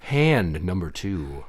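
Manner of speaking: low, slow, deliberate call; slow announcer call. Acted. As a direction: announce the round slowly. Game referee call.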